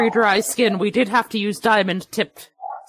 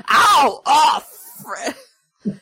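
mock cry of pain; 5 semitones higher, 3 dB louder, much slower than usual. Fist in the face.

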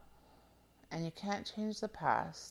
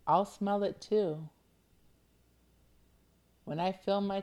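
slow affirmation reading. Shown as a demonstration. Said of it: "Just meditate on just the first line".

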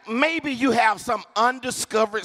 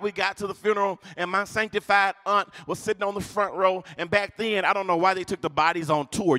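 usual speaking voice

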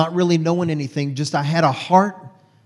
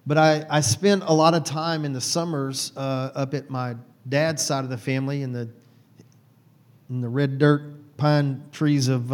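ordinary delivery

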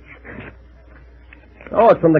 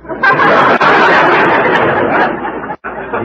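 laugh; 4 semitones higher than usual. Real, not acted. Laughs again.

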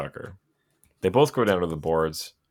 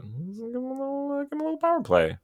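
very high, fast squeaky voice, teasing the refs for gifting a power play; a cartoonish high-pitched ref taunting the Penguins; mock referee voice; faster than usual. Mocking the referee's fake generosity.

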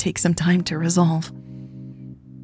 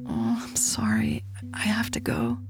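very soft, higher, near-whispered regret; hushed apology; 6 semitones higher, 10 dB quieter than usual. Acted. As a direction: murmur a tender goodbye. Tender whispered goodbye at parting.

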